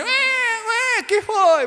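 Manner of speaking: put-on high-pitched wailing like a shocked child; child's mock wail. Child who put a finger in the socket, mock wailing.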